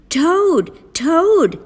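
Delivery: high, loud call with a falling end; shouting. A narrator's reading. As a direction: call out for a friend at the door. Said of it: "shouted Frog".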